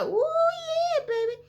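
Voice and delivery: put-on high, gleeful ooh yeah baby; thrilled and a bit cheeky; excited. Excited reaction to books.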